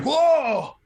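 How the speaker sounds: high, loud shocked yelp; jolted by the fence, cry it out; startled whoa. Retold or imitated. Retelling the fence shock, farmer's startled high yelp.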